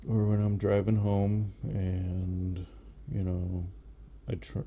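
ordinary talk, nothing marked

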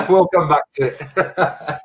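slow, laughing it off; laughing at own tongue twister. Real, not acted. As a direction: chuckle through the words. Laughs after forgetting phrase.

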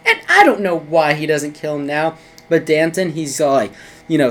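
usual speaking voice

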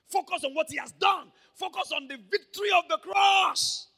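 loud, high, climactic preaching shout. Real, not acted. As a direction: raise the roof on the cross. Preacher exhorts the congregation.

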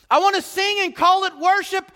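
high, loud mocking churchgoer wanting fun; selfish churchgoer. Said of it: "voicing churchgoer again".

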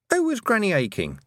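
a sharp, high question from an unseen speaker. A narrator's reading. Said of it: "said a voice".

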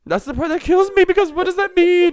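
high, loud, laughing-crying; words squeezed out through laughter. Real, not acted. To her friend, laughing at the weird confession.

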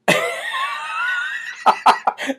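real laugh breaking out; high shriek of laughter. Real, not acted. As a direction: burst into a shrill cackle. Laughing at Democrats' list.

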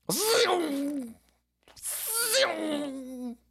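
high, soft whooshing zoom; jet sound effect. Retold or imitated. Host imitating a German jet, high and soft. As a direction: whoosh like a jet flying past. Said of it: "imitating a jet".

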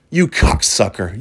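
slow, low, venomous insult. Shown as a demonstration. Podcaster showing how the insult can sting, venomous. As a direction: draw it out low and put venom in it. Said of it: "you can put some venom in it".

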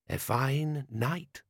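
slow, measured character voice; desert stranger. A narrator's reading. Stranger beside Vimes at night, slow character voice.

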